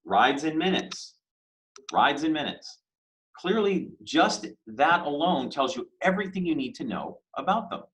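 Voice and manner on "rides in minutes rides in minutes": slow tagline read. Shown as a demonstration. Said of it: "Let's look at Lyft".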